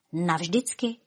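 small, quiet scared question; frightened. A narrator's reading. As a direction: echo it softly in dread. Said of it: "Miluška dostala strach".

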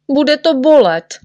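high, loud, falling protest; high-pitched sulky objection; fearful insistence. Acted. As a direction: insist stubbornly that pain is coming. Patient insists it will hurt.

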